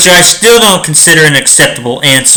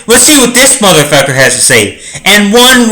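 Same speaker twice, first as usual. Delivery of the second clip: ordinary delivery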